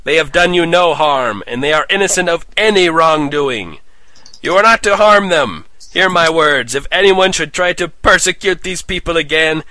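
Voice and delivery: loud ringing hero speech; booming proclamation. Acted. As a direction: address the crowd with authority. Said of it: "his powerful voice rang out throughout the arena".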